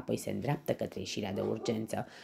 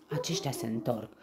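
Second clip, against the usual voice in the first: usual speaking voice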